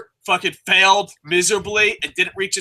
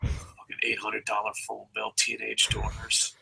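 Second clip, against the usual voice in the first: whispered rant about the phone bill; whispered grumble; 10 semitones lower, 13 dB quieter than usual. Real, not acted. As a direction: grumble it under your breath. Muttering complaint about phone bill.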